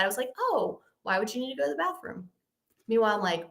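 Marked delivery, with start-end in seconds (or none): 0.4-2.3 soft, quick, casual question; feigned cool and calm. Retold or imitated. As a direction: ask it lightly, stuffing the alarm inside. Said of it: "trying to act really cool and calm".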